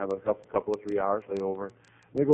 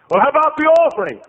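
high, loud, excited; eager questioning; 13 dB louder than usual. Retold or imitated. Visitor quizzes receptionist about glory days, high and excited. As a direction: pepper her with eager questions.

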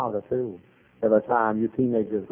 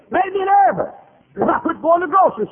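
very high, loud mimicked wail; shrill outburst in the lady's voice; frantic nervous lady; 5 dB louder than usual. Lady complaining she quit the grocery store, high frantic cry.

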